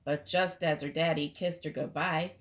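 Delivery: ordinary delivery, nothing marked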